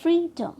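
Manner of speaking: "Freedom" with free high and loud; first-syllable stress. Shown as a demonstration. Teacher demonstrating the rule, free stressed in "freedom". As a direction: punch free, drop away on डम in "freedom". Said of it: "इस syllable में first syllable को trust किया है".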